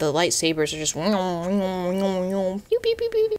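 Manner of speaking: slow drawn-out pew zaps; lightsaber pews. Shown as a demonstration. Vlogger doing lightsaber noises for her busy brain, zappy pews. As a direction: shoot little laser sounds. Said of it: "Star Wars fight scene".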